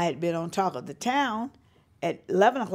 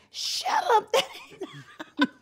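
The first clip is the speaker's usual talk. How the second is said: laughing disbelief; much slower than usual. Retold or imitated. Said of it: "That's a brother".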